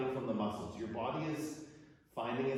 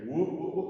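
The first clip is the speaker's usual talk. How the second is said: quick startled whoops as balance slips; wobbling whoops; 5 semitones higher, 6 dB louder than usual. Real, not acted. Teacher nearly falling out of her headstand, startled whoops. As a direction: yelp as you nearly topple. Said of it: "Oh, almost lost it".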